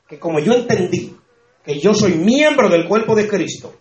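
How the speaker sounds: ordinary delivery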